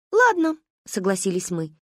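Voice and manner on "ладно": put-on high eager child voice; childish chorus. A narrator's reading. Girls answer the uncle brightly in a high voice. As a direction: chime in like a little girl.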